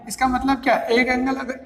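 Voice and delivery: ordinary delivery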